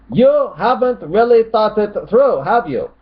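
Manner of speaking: high, loud taunting objector; mock critic's voice. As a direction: scold in a high put-on voice. Reads out a critic's comment.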